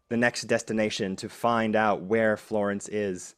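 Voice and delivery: low, soft, measured storytelling; game master narration. A narrator's reading. Game master closes the mystery of Florence, measured narration. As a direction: narrate the epilogue gravely, pausing between phrases.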